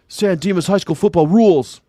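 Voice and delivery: put-on high, hyped-up teen cheer; movie-quote shout. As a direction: yell it like a pep-rally boast. Quoting Bill and Ted film.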